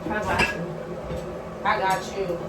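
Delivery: low, menacing vow of revenge; low threat. Real, not acted. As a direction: promise payback, quietly and low.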